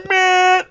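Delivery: long, high, held honk; car horn imitation. Driver honking at woman holding up the line, blaring. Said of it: "put my hand on my horn".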